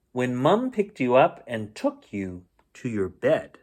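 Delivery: ordinary delivery, nothing marked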